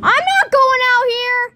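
high, loud, frightened protest; scared refusal. Real, not acted. Refuses to go into storm.

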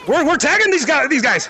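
normal talking voice

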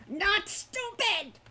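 high, drawn-out creature shriek; shrieking kobold. A narrator's reading. Captive kobold protesting to Hawk, shrieking. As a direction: shriek it like an indignant little monster. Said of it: "it shrieked suddenly".

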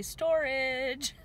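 ironic cheer for storage; sarcastic yay; mock enthusiasm. Real, not acted. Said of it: "'cause yay".

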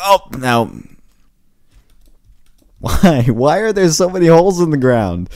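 loud, high exasperated cry; exasperated yell. Real, not acted. Gamer yelling at holes in the ground, exasperated.

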